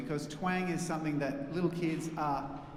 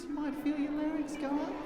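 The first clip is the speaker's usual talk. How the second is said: put-on high childlike voice with larynx raised; little kid voice; 10 semitones higher than usual. Shown as a demonstration. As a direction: talk like a small kid, pitched way up. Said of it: "start talking like a little kid like this".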